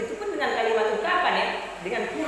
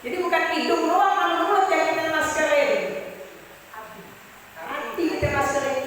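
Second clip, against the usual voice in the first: emphatic preaching; 6 semitones higher than usual. Real, not acted. Preacher's rising punchline.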